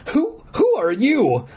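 high, slow scared stammer; high slow scared question to the voice; frightened cry. A narrator's reading. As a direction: stammer it into the dark. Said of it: "I cried".

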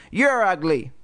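lightly squeaky dummy retort; Slappy, squeaking. A narrator's reading. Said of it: "in Lindsay's squeaking voice".

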